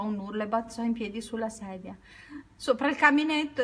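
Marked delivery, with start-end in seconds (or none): 2.6-3.6 higher, louder alarmed cry; exclaiming. A narrator's reading. Peter warns Judy about the python, loud alarmed cry. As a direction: point and warn her at once. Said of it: "esclamò Peter".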